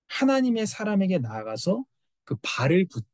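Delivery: ordinary talk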